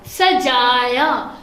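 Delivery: very slow सजाया with each syllable drawn out; slow model reading. Shown as a demonstration. Teacher models the word सजाया slowly for students. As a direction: stretch सजाया for the class. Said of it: "ये अलिफ या".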